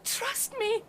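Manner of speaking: begging through tears; tearful plea. Acted. Wife begging her husband not to enter, tearful. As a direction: plead with him, near crying.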